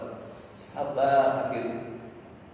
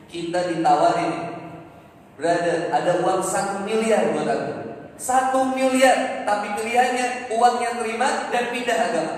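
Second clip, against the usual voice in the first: raised, ringing sermon voice, higher and louder; preaching; 11 semitones higher, 10 dB louder than usual. Acted. Preacher exhorting the congregation on lost property, full force. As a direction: preach it out to the crowd.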